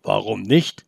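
a wolf shrugging off the question; wondering wolf. Acted. Wolf puzzled by the boy's surprise, low and wondering. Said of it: "wunderte sich der Wolf".

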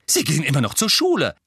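fast, loud, high objection; heated retort. A narrator's reading. Mother arguing back at her husband, heated retort.

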